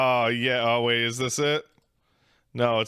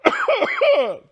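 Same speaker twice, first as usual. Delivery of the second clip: high, louder cackling burst; loud burst of laughter; 7 dB louder than usual. Real, not acted. Streamer bursting out laughing at the tweet he read to chat. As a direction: explode into laughter.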